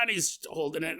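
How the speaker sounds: usual speaking voice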